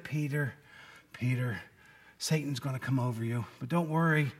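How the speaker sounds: soft, gentle reassurance to a friend; soothing. Retold or imitated. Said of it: "it's soothing".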